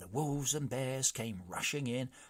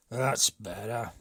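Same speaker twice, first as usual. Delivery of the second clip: loud, slow grumbling chief; the brigand chief, fed up; slow loud complaint from the chief; gruff chief; 8 dB louder, slower than usual. A narrator's reading. Chief approving story.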